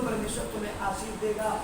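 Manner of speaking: said softly, almost under the breath; a quiet, low-key blessing. Shown as a demonstration. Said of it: "अगर मैं बोलूं".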